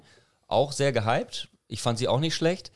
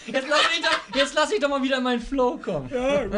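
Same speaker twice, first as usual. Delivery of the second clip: laughing; 12 semitones higher than usual. Real, not acted. Giggling through the countdown.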